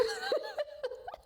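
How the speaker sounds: a soft, high laugh trailing off; breathy laugh. Real, not acted. Giddy about her app news, a soft high laugh. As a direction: let the laugh fade out. Cracking up on camera.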